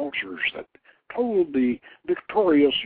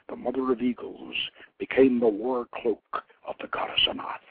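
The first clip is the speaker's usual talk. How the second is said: ordinary delivery, nothing marked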